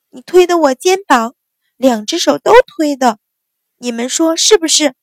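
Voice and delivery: high, loud indignant accusation; angry accusation. A narrator's reading. Sister angrily accusing elder sister before the maids, high loud. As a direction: demand the maids back her up.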